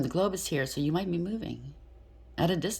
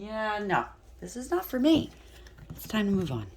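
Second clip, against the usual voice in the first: fed-up resolve; 5 semitones higher than usual. Retold or imitated. You deciding to leave family.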